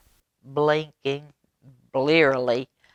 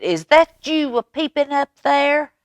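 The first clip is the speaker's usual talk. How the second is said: a light demanding possum voice, higher; 12 semitones higher, 4 dB louder than usual. A narrator's reading. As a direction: push the question up at them. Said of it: "demanded Possum".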